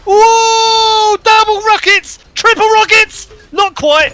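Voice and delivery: high, loud play-by-play shout; excited shouting. Real, not acted. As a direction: yell the big play. Live play-by-play of a big play.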